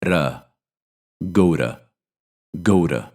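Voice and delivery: "to" said as "ra" with a flap; weak "to" with a flapped r sound; reduced to. Shown as a demonstration. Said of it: "sounds like ra".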